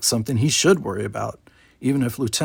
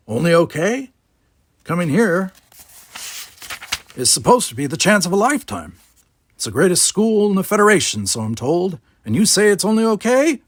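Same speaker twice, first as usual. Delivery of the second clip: put-on grumpy old man; 7 semitones higher, 5 dB louder than usual. Gardener incredulous.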